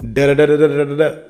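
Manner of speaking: fast, empty da-da babble mocking a politician; quick mock of a long-winded answer; mocking rambling babble. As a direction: rattle off nonsense syllables to mock the waffling. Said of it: "took two fucking minutes to say one sentence".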